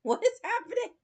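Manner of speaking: imitating a startled driver up high; mock Uber driver, high. Imitating the driver, high and startled. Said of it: "he was like".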